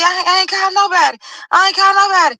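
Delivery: high frantic answer; panicked. Retold or imitated. Telling mom she called nobody, high and frantic. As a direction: blurt it out in panic. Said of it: "I'm like".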